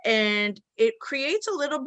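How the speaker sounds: normal talking voice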